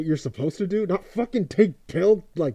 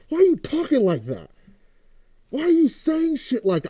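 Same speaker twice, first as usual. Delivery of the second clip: much higher, incredulous questioning; exasperated confrontation; 11 semitones higher than usual. Acted. Fan asking [name] why he talks like that, high and exasperated. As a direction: pitch it way up in disbelief.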